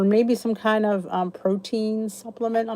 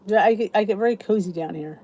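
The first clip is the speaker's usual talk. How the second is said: ordinary talk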